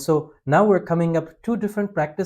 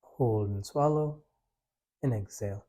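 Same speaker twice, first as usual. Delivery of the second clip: guided breathing; 3 semitones lower, 7 dB quieter than usual. Acted. Leads a breathing practice.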